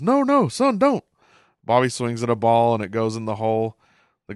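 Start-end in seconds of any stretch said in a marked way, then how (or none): 0.0-1.0 much higher, louder mock alarm; hammed-up dad stopping his son; Hank's alarmed warning. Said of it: "Hank says".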